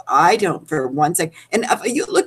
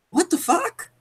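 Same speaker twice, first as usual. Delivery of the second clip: high, incredulous squeak of disbelief; mock-offended shriek, pitched way up; stunned outrage; 4 dB quieter than usual. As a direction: react like you can't believe who just showed up. Quoting Brandy's reaction to Andy.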